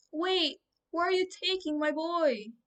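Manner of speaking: high frantic yell; mother yelling at the soldier taking her son. A narrator's reading. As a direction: shout after them in alarm. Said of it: "she yelled".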